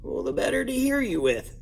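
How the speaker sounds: high, put-on disguised wolf voice; raspy voice. A narrator's reading. Wolf in bed fakes granny's voice for Bundy. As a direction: squeak it out like a wolf playing granny. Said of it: "said the raspy voice".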